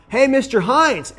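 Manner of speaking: put-on high, loud, drawn-out kid voice; acted-out child hailing a grown-up; imitated schoolkid. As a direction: call out like a kid in class.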